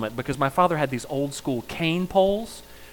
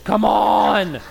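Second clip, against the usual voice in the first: loud, higher exasperated groan of a losing player; frustrated outburst; 5 semitones higher, 3 dB louder than usual. Acted. Card player fed up with opponent, exasperated loud higher "come on". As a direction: throw up your hands at the card game. Acted Go Fish card game.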